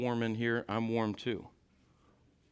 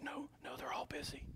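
put-on child's whisper; 16 dB quieter than usual.

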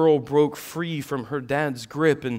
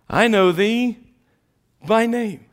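higher, louder proclamation of God's words; loud proclaiming; 11 semitones higher, 9 dB louder than usual. Shown as a demonstration. Preacher repeating God's promise to Moses, now loud and high. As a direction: boom it out the second time. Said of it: "second, louder take".

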